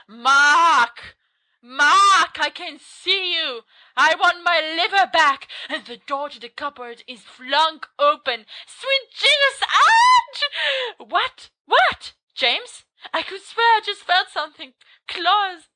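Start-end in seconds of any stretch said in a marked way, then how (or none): 0.1-5.5 loud, high, drawn-out menace; spooky calling voice. Acted. Voice finds Mark hiding in cupboard, high loud ghostly wail. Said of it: "And the voice goes". 8.7-11.1 very high, loud yelp; startled shriek. Acted. Listener jumps at the cupboard scare, high startled shriek. 11.1-15.7 high-pitched jittery questioning; panicked alarm. Acted. Frightened listener felt claws during the story, high panicked voice.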